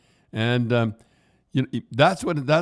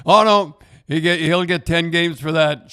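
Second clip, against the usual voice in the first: mock alarmed shout; 7 semitones higher, 8 dB louder than usual. Mocking Ron's on-air prediction.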